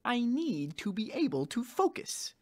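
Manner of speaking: lightly voiced frog-puppet doctor; Kermit the Frog. Doctor regains focus during delivery, as mock Kermit. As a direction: settle down, mild puppet voice. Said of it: "talking like Kermit the Frog".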